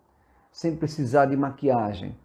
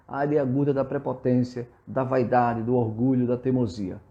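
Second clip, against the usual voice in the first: ordinary talk, nothing marked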